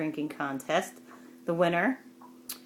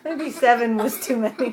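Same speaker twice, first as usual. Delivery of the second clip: laughing; 4 semitones higher, 3 dB louder than usual. Real, not acted. Host still laughing.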